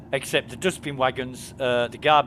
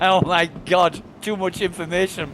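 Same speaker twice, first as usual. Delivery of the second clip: mock shocked reaction; 5 semitones higher, 7 dB louder than usual. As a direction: exclaim it like you heard far too much. Retells his shocked reaction to oversharing.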